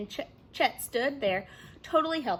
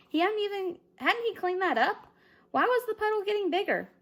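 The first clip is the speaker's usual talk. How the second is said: dazed confusion; 9 semitones higher than usual. A narrator's reading. Chet dazed, confused inner thought.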